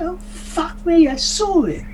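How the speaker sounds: put-on high, drawn-out English accent; high-pitched mock-English astonishment. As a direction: exclaim in a put-on English dialect. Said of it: "if I can do an English dialect".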